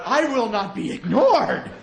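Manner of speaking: high, then slow and menacing mock indignation; mock outrage. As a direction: ham up being snubbed. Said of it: "you're like".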